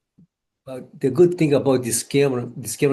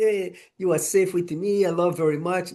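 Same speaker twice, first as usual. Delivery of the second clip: mock sugary self-love; 6 semitones higher than usual. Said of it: "And I mean".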